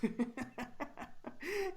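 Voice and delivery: a high amused chuckle. Acted. Bandit chuckling at the stranger. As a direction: laugh off the outsider. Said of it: "he chuckles".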